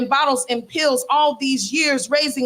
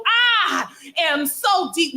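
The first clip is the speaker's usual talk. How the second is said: higher, slow, half-shouted refrain; mock profound shout; 6 semitones higher, slower than usual. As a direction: proclaim it like a prophet. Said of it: "in this tone of voice".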